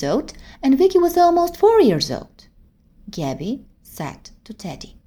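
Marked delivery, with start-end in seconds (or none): none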